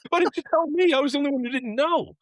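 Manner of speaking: high, laughing mock outrage at his mom; laughing disbelief. Retelling his mom's confession, a laughing high-pitched outcry. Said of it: "I'm like".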